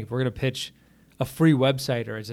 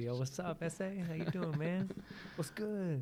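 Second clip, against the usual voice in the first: street slang greeting; 5 semitones higher, 10 dB quieter than usual. Retold or imitated. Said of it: "It's like".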